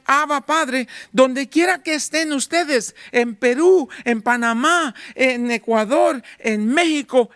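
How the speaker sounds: full-force sermon voice; fiery preaching. Acted. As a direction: preach it out to the congregation.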